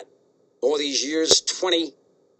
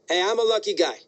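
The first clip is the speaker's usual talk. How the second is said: normal talking voice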